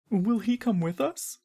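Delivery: put-on a younger boy asking hopefully. A narrator's reading. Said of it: "asks Bach".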